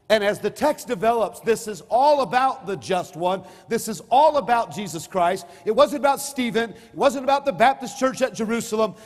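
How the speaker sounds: loud, high, slow preaching to the congregation. Acted. Pastor preaching loudly to his congregation. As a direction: preach it out to the pews.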